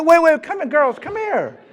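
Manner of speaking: put-on laughing call after the departing girls; calling them back. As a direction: wave them back urgently.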